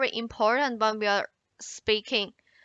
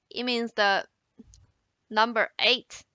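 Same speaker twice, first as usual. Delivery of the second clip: ordinary talk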